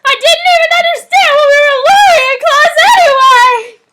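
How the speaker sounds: over-the-top protest; hammed-up Naruto. Naruto complaining.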